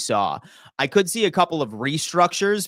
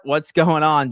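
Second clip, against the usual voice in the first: usual speaking voice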